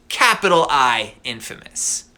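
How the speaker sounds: usual speaking voice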